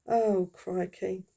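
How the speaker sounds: ordinary talk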